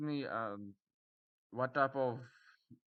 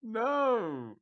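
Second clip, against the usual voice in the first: mock disapproval; 5 semitones higher, 7 dB louder, much slower than usual. Real, not acted. Rejecting weird text.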